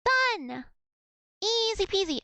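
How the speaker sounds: high bright cheer of triumph. Real, not acted. Finishes Russian exercise, cheers.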